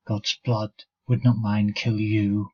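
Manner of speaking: plain reading voice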